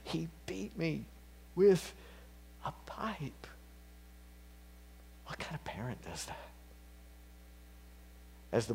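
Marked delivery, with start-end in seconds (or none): 0.0-3.5 higher, broken voice fading to a whisper; hint of the boy's sobs, trailing off; mild imitation of a sobbing boy; retelling sobbing words. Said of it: "repeated again for emphasis between his sobs".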